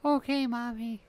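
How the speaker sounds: put-on little girl voice.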